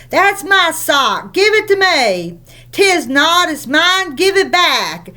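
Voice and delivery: put-on high, squabbling child voices; children quarreling. The twins fighting over a sock, squabbling.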